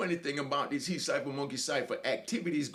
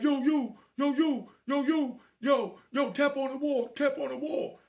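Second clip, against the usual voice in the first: high, loud, repeated calls; urgent calling; 11 semitones higher, 5 dB louder than usual. Retold or imitated. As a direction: pester the man next door through the wall. Neighbour calling through cell wall.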